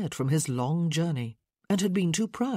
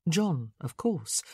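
ordinary delivery, nothing marked

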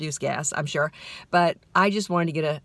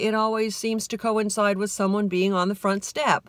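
ordinary delivery

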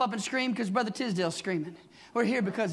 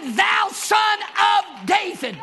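louder and very slow, each word drawn out; slow loud cry; 9 semitones higher, 8 dB louder, much slower than usual. Acted. Blind man calling Jesus the son of David, slow loud cry. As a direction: stretch the title out like a cry to the Messiah. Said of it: "And he said".

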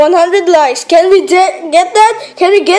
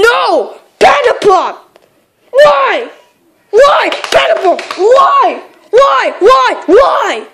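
desperate wailing; 5 semitones higher, slower than usual. Real, not acted. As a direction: wail it in despair. Dying in horror game.

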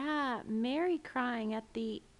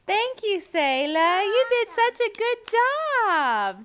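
much higher, louder, gushing praise; excited praise; 10 semitones higher, 10 dB louder than usual. Real, not acted. Parent praises child's reading.

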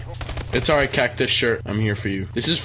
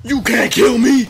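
loud, high-pitched boast; taunting shout; 8 semitones higher, 7 dB louder than usual. Real, not acted. Gamer who almost got killed, taunting shout. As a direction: crow it at the opponent.

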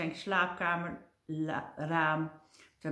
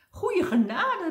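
high, loud alarmed exclamation; a fright in a much higher voice; startled cry; 12 semitones higher, 6 dB louder than usual. Acted. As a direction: jump out of your skin, much higher and louder. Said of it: "riep meneer Doodsbenauwd".

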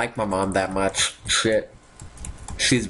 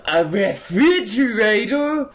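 mock amazement; 9 semitones higher, 4 dB louder than usual.

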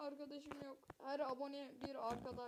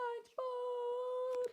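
drawn-out wail; 11 semitones higher, 6 dB louder, much slower than usual. Pretend. Drawn-out call.

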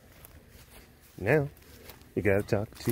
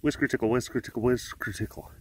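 rattled off fast; fast tongue twister; 4 dB quieter than usual. Shown as a demonstration. Keeper rattles off whisker tickle as a tongue twister, fast. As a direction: rush the tongue twister three times. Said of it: "Say that three times fast".

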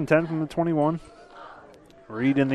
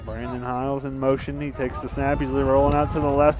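ordinary talk, nothing marked

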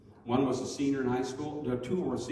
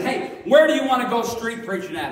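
eager call; 11 semitones higher, 10 dB louder than usual. Retold or imitated. Eager question to friends in car.

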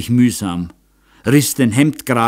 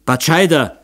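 higher, louder wild cry of a name; frantic shout; 7 semitones higher, 4 dB louder than usual. A narrator's reading. The count screams for his hunter, frantic. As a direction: yell the name like a man losing his mind. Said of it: "schrie Graf Ecke wie ein Irrsinniger".

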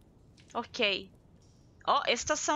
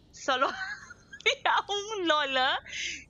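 slow, with a laugh breaking in; 6 semitones higher, much slower than usual. Real, not acted. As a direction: laugh mid-sentence. Laughs while reading names.

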